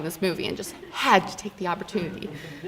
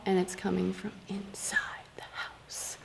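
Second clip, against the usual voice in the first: whispered ghost-story reveal; spooky whisper; 14 dB quieter than usual. Acted. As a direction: drop to a whisper for the twist. Reveal of the horror twist.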